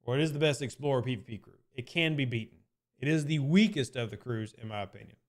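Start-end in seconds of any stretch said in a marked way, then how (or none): none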